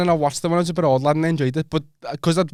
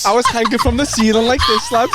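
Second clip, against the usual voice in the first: mock excited; 9 semitones higher, 6 dB louder than usual. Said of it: "just in the top corner like that".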